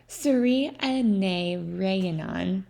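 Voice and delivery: Suri ane rianan drawn out very slowly, syllable by syllable; careful pronunciation. A narrator's reading. Narrator showing listeners how his surname sounds, slowly. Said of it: "is my surname".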